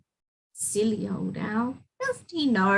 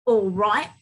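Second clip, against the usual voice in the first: ordinary delivery, nothing marked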